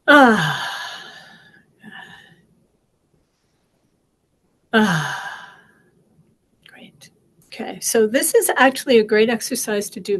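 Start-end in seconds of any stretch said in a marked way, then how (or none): 0.1-5.6 audible exhale. Real, not acted. Deep breaths after squeezing hands.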